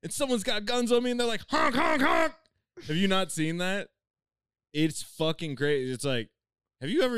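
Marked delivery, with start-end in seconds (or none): 0.0-2.3 much higher, fast mocking voice. Mocking a rapper bragging about guns, high and fast. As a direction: squeak it like a tough guy faking it. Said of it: "he's like".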